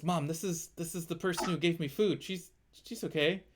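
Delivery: a little creature vouching for a friend to its mom; small creature pleading. Acted. GM voices small creature to mother.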